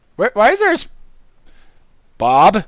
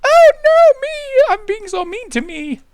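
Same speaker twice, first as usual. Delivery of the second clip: much higher, squeaky mock distress; hammed-up whiny wail; mock whiny self. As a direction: wail like a hurt little kid. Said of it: "I'd be like".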